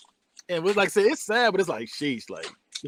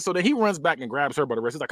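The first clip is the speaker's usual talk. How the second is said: normal talking voice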